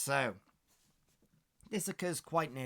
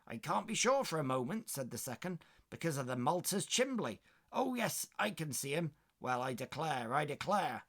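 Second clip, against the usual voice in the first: higher, louder West Country maid; eager West Country gossip; West Country accent; 5 semitones higher, 4 dB louder than usual. Acted. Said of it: "Trying to do a West Country accent there".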